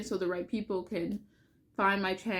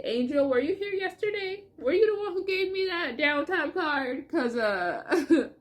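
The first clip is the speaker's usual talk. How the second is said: high, over-the-top tearful questioning; dramatic high plea edged with laughter; being extra; 8 semitones higher, 4 dB louder than usual. Real, not acted. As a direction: call up to the angel, hammed-up and overcome. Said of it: "I'm just being extra".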